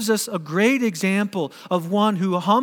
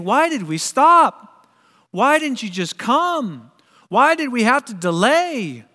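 frustrated anger; 5 semitones higher, 5 dB louder than usual. Acted. Father responding to Jesus over the delay, angry protest. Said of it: "possibly his anger rose with Jesus".

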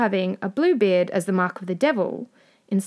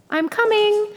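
very high sing-out reply; high, hurried-sounding answer to a threat; calling back; 13 semitones higher, 4 dB louder than usual. A narrator's reading. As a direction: call back in a high, anxious voice. Wife calls back to Bluebeard.

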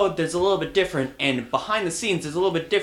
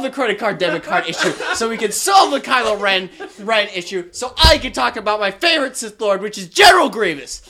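loud, higher laughing rant; exasperated rant; 4 semitones higher, 5 dB louder than usual. Real, not acted. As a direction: vent your frustration, half laughing. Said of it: "he's so angry".

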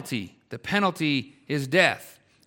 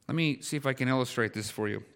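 ordinary talk

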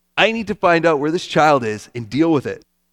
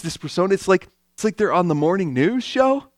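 ordinary delivery

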